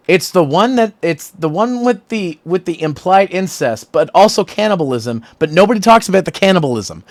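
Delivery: over-explain it to a confused friend; mock frustrated outburst; retold exasperated explanation. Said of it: "I'm like".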